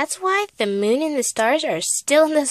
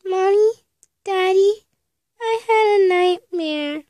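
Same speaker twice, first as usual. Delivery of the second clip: put-on high, plaintive little-kid voice; small child; 7 semitones higher, 5 dB louder, slower than usual. Child wakes parents after nightmare, plaintive. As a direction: whine it slowly like a child.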